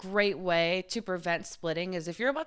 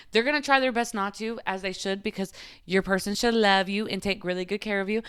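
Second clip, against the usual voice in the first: normal talking voice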